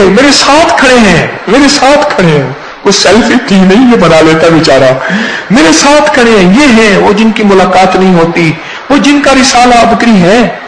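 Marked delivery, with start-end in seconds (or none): 0.1-2.5 excited boasting. Retold or imitated. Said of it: "جو کہتی ہے". 5.5-10.6 excited boasting. Retold or imitated. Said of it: "جو کہتی ہے".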